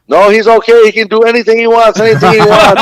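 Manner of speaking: high, loud mock mob guy; a hammed-up little mob boss insisting; mob guy quote. Little mob guy tells the bouncer to back off, high and loud.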